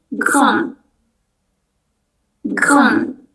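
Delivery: grand dibaca gron, tanpa D; grand, D akhir tidak dibunyikan; silent final D. Shown as a demonstration. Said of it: "Huruf D terakhir itu nggak dibaca". (grand tanpa D)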